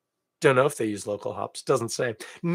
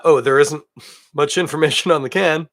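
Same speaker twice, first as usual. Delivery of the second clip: ordinary delivery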